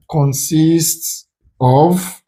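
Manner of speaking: very slow, stretched words; slow, spaced-out option reading; drawn-out reading. Shown as a demonstration. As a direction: draw each word right out. Reads option slowly, then repeats it.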